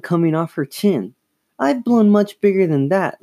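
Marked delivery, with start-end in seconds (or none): 1.6-3.2 high-pitched, mildly sneering boast; superior sneer. A narrator's reading. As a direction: boast it high, looking down on sister. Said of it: "said with a superior sneer".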